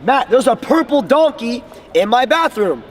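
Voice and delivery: hyped-up announcement to a friend; excited. Shown as a demonstration. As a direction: sell it like the most thrilling thing ever. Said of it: "if you're not excited on the call".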